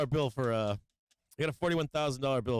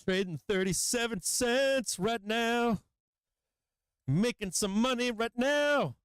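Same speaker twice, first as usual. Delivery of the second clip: gloating taunt; 8 semitones higher than usual. Real, not acted. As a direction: taunt the losing traders with glee. Gloating at short sellers as price rises.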